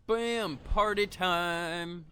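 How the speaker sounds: high, loud, stretched-out hype call; gleeful streamer shout; party time cheer. Real, not acted. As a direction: announce the party, drawing out each word. Celebrating lights turning on.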